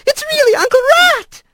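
very high little mouse voice; squeaky child bursting with news; excited squeal. A narrator's reading. Little mice running to their aunt, squealing. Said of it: "squealed up to Kylie".

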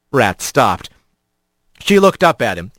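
ordinary delivery, nothing marked

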